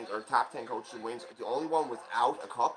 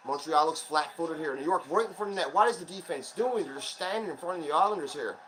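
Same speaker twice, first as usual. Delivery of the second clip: live play-by-play; 3 semitones higher than usual. Real, not acted. Fan narrating the hockey game live for viewers, excited play-by-play. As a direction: call every rush as it happens.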